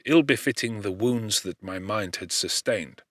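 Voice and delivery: plain reading voice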